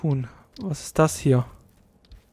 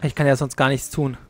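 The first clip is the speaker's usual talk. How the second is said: normal talking voice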